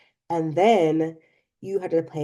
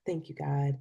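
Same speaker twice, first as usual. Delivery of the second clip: relieved whisper; 3 semitones lower, 9 dB quieter than usual. Real, not acted. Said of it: "relieved prayer".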